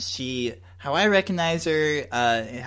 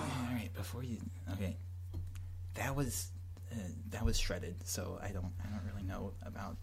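whispering; 6 semitones lower, 16 dB quieter than usual. Acted. Answers the whisper quietly.

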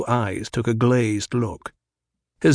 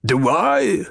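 Baron voice; 5 semitones higher than usual. A narrator's reading. Baron speaks.

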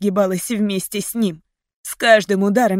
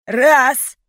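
loud, higher shouted count; threatening count; 5 semitones higher, 6 dB louder than usual. A narrator's reading. Captor counting before shooting, menacing shout. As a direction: bark the number as a threat.

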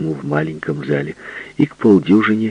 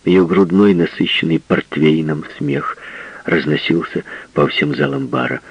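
usual speaking voice

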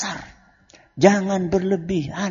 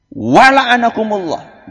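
angry; 4 semitones higher, 7 dB louder, slower than usual. Acted.

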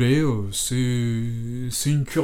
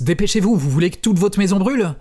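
higher and louder, pressing her to hurry; impatient urging; 7 semitones higher, 5 dB louder than usual. Acted. Johnny urging Hélène to call the firemen: impatient and exasperated. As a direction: push her to act now, clearly exasperated. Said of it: "reprit-il avec impatience".

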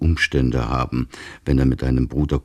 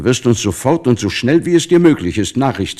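high, loud and pressing; urgent loud plea; 9 semitones higher, 6 dB louder than usual. Acted. Family begs departing son to send news, loud urgent plea. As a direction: call it out to him before he leaves.

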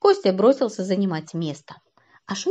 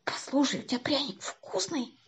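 soft, higher strained croak; hoarse croak; 5 semitones higher, 7 dB quieter than usual. A narrator's reading. Kostya questions friend after a nasty bite, croaking. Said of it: "плюнул и прохрипел".

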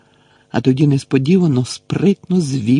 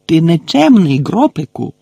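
loud, higher scolding; scolding shout; 4 semitones higher, 6 dB louder than usual. A narrator's reading. Gamekeeper scolding the giant for grabbing, loud. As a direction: tell him off hard. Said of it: "закричав Гегрід".

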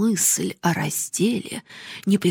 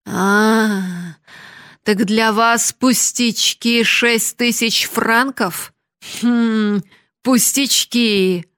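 scoffing; 3 semitones higher, 5 dB louder, much slower than usual. Acted. Grandet scoffing at "trifles.

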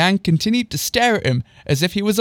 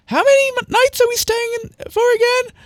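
very high squeaky question; C C's voice. Acted. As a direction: ask it in a falsetto squeak. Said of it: "I forget what C C's voice is".

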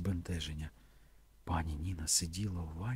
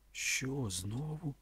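higher, soft, hesitant question; unsure protest; 5 semitones higher, 4 dB quieter, much slower than usual. A narrator's reading. Man protests at her advances, unsure. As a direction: protest weakly, without conviction. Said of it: "невпевнено протестував він".

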